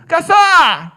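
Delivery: high, loud call for the waiter; woman shouting for the waiter, loud. Retold or imitated. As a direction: summon the waiter across the room.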